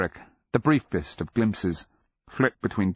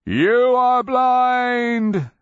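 huge drawn-out high shout; 8 dB louder, much slower than usual. A narrator's reading. Cleric taunting the blind beast, shouting. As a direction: bellow each word long, high and loud. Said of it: "Cleric shouted".

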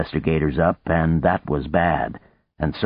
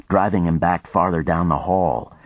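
plain reading voice

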